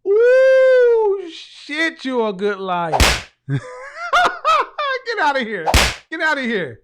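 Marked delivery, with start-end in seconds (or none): 0.1-1.3 very high, loud squealing "Ooh"; high whoop. Real, not acted. Host overwhelmed by caller's comment, high loud whoop. 3.5-6.8 louder, higher repeated dismissal; incredulous. Real, not acted. Host dismissing caller Karen, loud incredulous repeats.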